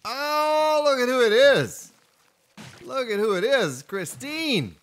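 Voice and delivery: loud, high, delighted welcome; excited greeting. Real, not acted. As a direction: hail a newcomer with a big high whoop. Greeting a viewer who turns up.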